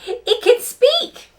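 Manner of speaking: big, loud build to the doll's name; excited storytelling. Acted. Teacher telling kids about Trixie's new doll, excited storytelling. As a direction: sell the new toy like a thrilling surprise. Narrates the surprise gift.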